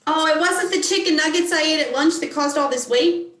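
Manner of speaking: high-pitched, playful mock surprise; mock disbelief. Trainee joking to the coach about lunch and weight, mock disbelief. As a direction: feign innocent disbelief as a joke. Jokes back with feigned disbelief.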